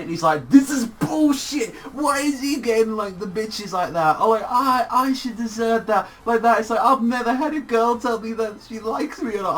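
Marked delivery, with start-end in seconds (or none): 0.5-4.2 hammed-up indignant shouting, pitched way up; Okuyasu losing it. Okuyasu jealous of Koichi's confession, losing it in mock outrage. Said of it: "Okuyasu's losing it". 4.5-9.6 high-pitched mock self-pitying complaint; hammed-up lament in a raised voice; Okuyasu losing it. Okuyasu never had a girl confess, losing it in a high mock lament. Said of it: "Okuyasu's losing it".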